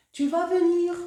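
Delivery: "tu vas venir" with the voice lifting high on "venir"; rising question tune. Shown as a demonstration. Teacher showing students a question by intonation alone, rising on "venir". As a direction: ask it by tune alone, pitch climbing on "venir". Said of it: "c'est une question كنعرفوها بالintonation".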